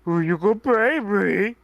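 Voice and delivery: put-on baby talk voice. Shown as a demonstration. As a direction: coo like talking to a cute puppy. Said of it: "just my baby talk voice".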